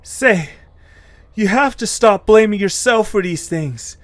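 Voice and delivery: put-on a young friend urging her to stop blaming herself; high-voiced Eche, grateful.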